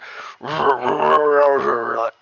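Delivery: imitated garbled, rumbling ogre noise; wordless garbled ogre voice imitation; distorted ogre gargle. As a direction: gurgle like a badly distorted voice. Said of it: "distorted his voice so badly".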